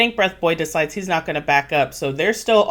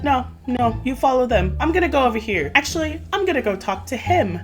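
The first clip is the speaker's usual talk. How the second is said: higher-pitched, breezy brush-off; mock dismissive; 7 semitones higher than usual. She turns Cole down for Rob, mock dismissive. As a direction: act out waving someone away. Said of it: "And she's like".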